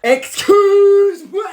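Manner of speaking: high, slow, stretched outraged cry; shocked outcry. Acted. Student shrieks at the teacher in shock, high and drawn out. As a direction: squeal it out in disbelief.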